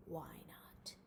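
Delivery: hushed whisper; whispered question. A narrator's reading. Grandpa recalls Wilbur's question, whispered. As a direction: breathe it.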